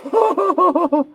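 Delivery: squeaky high laugh; high giggle. Real, not acted.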